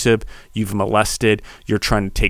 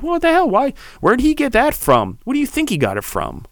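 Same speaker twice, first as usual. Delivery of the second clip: much higher, squeaky indignant questions; mock outraged parent. Parents whose kids copy them, mock indignant high voice. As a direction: play the baffled parent, pitch way up.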